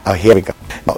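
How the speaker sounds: ordinary talk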